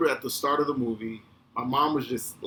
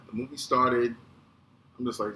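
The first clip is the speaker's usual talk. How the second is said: normal talking voice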